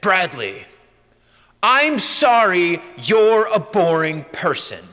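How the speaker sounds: gentle, slow, loving priest; lovingly. Retold or imitated. Priest gently teasing the bored student, slowly and lovingly. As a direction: break it to him kindly. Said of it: "The priest looked at me very lovingly".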